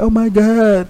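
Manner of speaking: high, loud shocked cry; shocked gasp. Retold or imitated. Girlfriend reacting to his yelling, high shocked cry. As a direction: gasp it out in alarm. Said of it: "This girl like".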